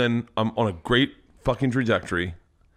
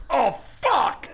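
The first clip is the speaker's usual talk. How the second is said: high, drawn-out cry imitating his wife; mock a sudden climax, pitched way up; imitated climax outburst; 12 semitones higher than usual. Comedian mimics his wife's orgasm for co-hosts, high outburst.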